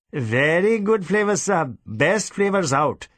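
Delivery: slow, wheedling seller's voice; fawning merchant. Acted. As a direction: sell him the drink, slowly. Merchant voiced, selling his drinks.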